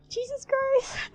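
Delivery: very high, slow shocked cry; shocked exclamation. Real, not acted. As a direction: blurt it out in disbelief. Shocked by the scene.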